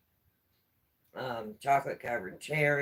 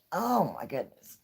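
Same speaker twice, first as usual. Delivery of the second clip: usual speaking voice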